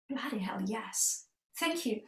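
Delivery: breathless stunned whisper. Retold or imitated. Admitting to the woman at the table, whispered. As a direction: whisper it like the truth just hit you.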